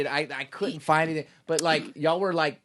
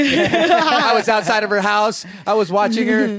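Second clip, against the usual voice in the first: slow, louder, laughing creepy confession; laughing mock-stalker; 5 semitones higher, 6 dB louder than usual. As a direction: confess it playfully through laughter. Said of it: "Now you sound like me".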